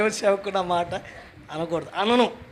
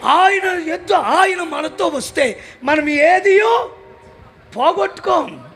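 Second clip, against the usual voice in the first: loud, higher, driving sermon climax; fiery preaching; 7 semitones higher, 8 dB louder than usual. Acted. Preacher rousing the congregation about God's power, shouting fervently. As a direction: hammer each line home to the crowd.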